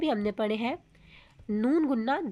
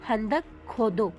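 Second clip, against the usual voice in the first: a bit slower, each word distinct; slow clear reading; slower than usual. Shown as a demonstration. Teacher reading an example to students, slow and clear. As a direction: spell it out with your voice. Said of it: "जो हम आपको पढ़ के सुनाएंगे".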